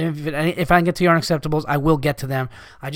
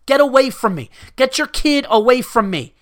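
higher, exasperated demand for space; angry warding off; 5 semitones higher than usual. Acted. Customer to a stranger crowding him, angry and higher. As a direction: tell them off, pitched up.

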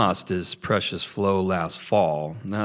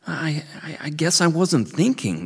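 put-on high, stammering young beaver on the edge of tears; voice cracking; 8 semitones higher than usual. A narrator's reading. Scared boy answering the old beaver, voice cracking. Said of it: "through a cracking voice".

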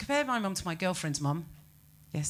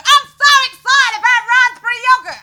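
shrieking high, loud over-the-top glee; mock ad excitement; 19 dB louder than usual. Comedian mocking a yogurt advert, shrill excited squeal. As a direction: ham up a gushing yogurt-ad woman. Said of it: "gets really excited about yogurt".

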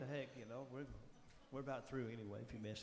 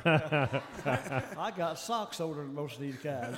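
laughing; 9 dB louder, much slower than usual. Real, not acted. Joking about the band's age.

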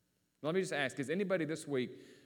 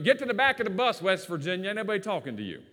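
higher, louder mock yell at a rival; joking heckle; 5 semitones higher, 6 dB louder than usual. Eagles fan ribbing a Redskins fan on the bus, joking loud heckle. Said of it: "he says to me, joking".

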